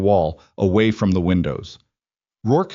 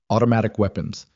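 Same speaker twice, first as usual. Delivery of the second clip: ordinary delivery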